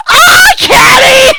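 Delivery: real fangirl squeal, very high and loud; delighted scream. Real, not acted. Player hears the YouTuber's own voice clip, delighted scream. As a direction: shriek the name in delight.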